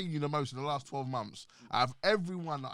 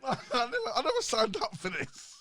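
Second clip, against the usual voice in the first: laughing protest; 12 semitones higher, 4 dB louder than usual. Retold or imitated. Still laughing at the situation.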